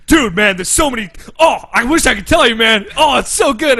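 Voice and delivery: high, loud mock excitement; hammed-up, giddy shouting enthusiasm; imitated gushing. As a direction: gush like a friend bursting with secrets. Retold friend gushing about secrets.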